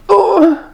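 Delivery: high-pitched, hammed-up squeak of dismay; helpless "oh". Traveler at a loss for words before agent, high helpless yelp. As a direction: let out one high, wordless yelp. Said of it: "all I was able to think of was".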